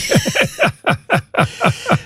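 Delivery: airy high giggle; high breathy laugh. Real, not acted. Host laughing about not airing the whole call.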